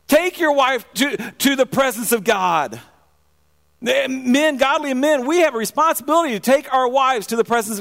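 rally the congregation, pleading and pushing; fervent preaching. Acted. Preaching climax, exhorting men.

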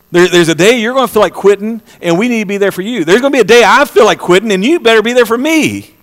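preacher urging mutual support; preaching. Acted. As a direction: build it up to the congregation. Preacher rising to a climax.

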